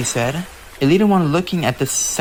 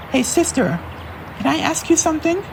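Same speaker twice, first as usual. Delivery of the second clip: high, quick eager question; eager little sister. Acted. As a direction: ask your sister on the road. Arcee asks while driving.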